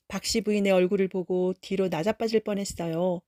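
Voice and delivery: plain reading voice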